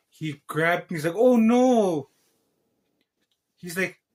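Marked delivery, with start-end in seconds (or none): 1.1-2.1 loud, high, drawn-out "oh no"; mock dismay. Teacher pretends the robot fell by accident, mock dismay. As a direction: put on a big fake dismay.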